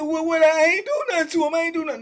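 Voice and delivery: high, panicked protest; terrified denial. Retold or imitated. Caught man protesting to the cellmate threatening him, high panicked pleading.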